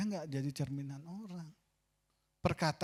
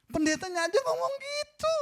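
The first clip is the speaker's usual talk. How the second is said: much higher and louder, breaking into a cry; 7 dB louder than usual. Real, not acted. Pastor confessing his words to the congregation, crying. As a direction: let the shame crack the voice.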